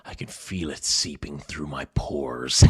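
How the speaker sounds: slow ghostly whisper; slow creepy whisper; eerie whisper. Pretend. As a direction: breathe it out like a spooky secret. Drunk streamer's eerie aside.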